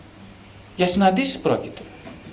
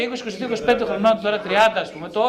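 usual speaking voice

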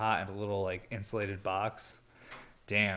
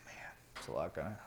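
whisper; 4 semitones lower, 10 dB quieter, faster than usual. Real, not acted. Muttering to self.

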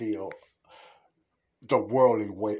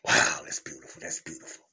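breathless whisper of wonder; awed whisper; 5 semitones lower, 5 dB louder than usual. Real, not acted. Preacher picturing heaven, whispering in awe. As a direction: hush it like you're in awe.

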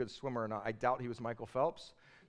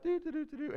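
high sung doo doo for the boat coming in; humming boat noise; 12 semitones higher, faster than usual. Retold or imitated. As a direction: hum the boat gliding up high. Boat arriving sound.

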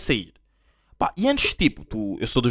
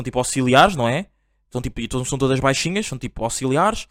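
ordinary talk, nothing marked

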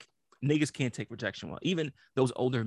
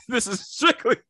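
higher, louder laughing disclaimer; giggling disclaimer; 9 semitones higher, 5 dB louder than usual. Real, not acted. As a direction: laugh while insisting it's a joke.

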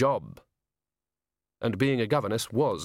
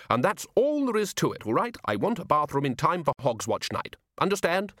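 firm; 5 semitones higher than usual. Acted. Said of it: "said Ridcully firmly".

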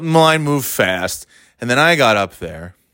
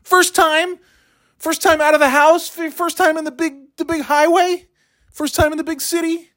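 mock yelling. Yelling at drivers braking on the on-ramp, high mock yell. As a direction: re-enact yelling at a driver, pitched way up. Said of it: "And I start yelling".

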